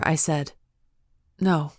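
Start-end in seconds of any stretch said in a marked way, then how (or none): none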